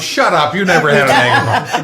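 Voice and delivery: loud, laughing disbelief; laughing scoff. Retold or imitated. Scoffing at the meek therapist, loud and laughing. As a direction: scoff at him, cracking up.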